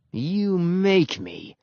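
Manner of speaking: very slow, high growly dare; defiant dog. A narrator's reading. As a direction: drag it out as a dare. Growling dog dares the other.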